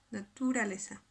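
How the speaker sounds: "naturaleza" with TU held and stressed; unnatural stress. Shown as a demonstration. Teacher showing it sounds bad, "naturaleza" with misplaced stress on TU. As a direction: wrongly lengthen the "tu" in "naturaleza". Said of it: "Esto suena poco natural".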